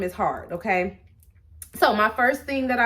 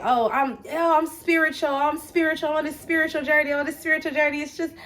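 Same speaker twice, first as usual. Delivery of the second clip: higher, airy-headed parody of spiritual believers; mocking spiritual folk; 6 semitones higher than usual. Vlogger mimicking naive spiritual people to viewers, mocking. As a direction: gush mockingly like a starry-eyed spiritual type. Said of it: "people think that".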